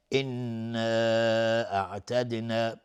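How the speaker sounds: انا held on a humming doubled noon, very slowly; nasal ghunna. Shown as a demonstration. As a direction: hum the doubled noon of انا for two counts. (غنه نون مشدد در انا)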